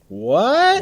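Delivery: high, loud startled what; sudden high astonished what; surprised. Real, not acted. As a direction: jump up in mock shock. Puzzled by own hooker question.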